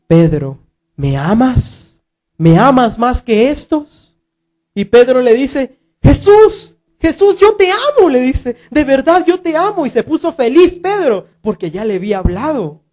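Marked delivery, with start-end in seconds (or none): 0.1-4.2 lower, slow gentle voice of Jesus; Jesus gently questioning Pedro; tender question. Acted. 6.0-9.8 eager devotion. Acted. Pedro answers Jesus.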